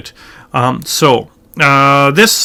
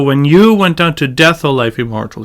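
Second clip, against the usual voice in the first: spoken with "you" and "death" emphasized; stress on you and death. Shown as a demonstration. Teacher showing where emphasis falls, stressing "you" and "death". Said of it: "Got emphasis here".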